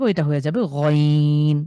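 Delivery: slow, drawn-out গইন from the back of the throat; slow letter demo. Shown as a demonstration. Teacher slowly sounds out গইন for the class. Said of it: "আইনের উপরে যখন আমরা একটা নোক্তা দেব".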